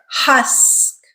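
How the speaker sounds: "Husk" with the uh falling in a clear curve; careful model "Husk", drawn into a falling curve; curved vowel. Shown as a demonstration. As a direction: let the stressed uh in "Husk" curve downward. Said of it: "Notice it has that curve".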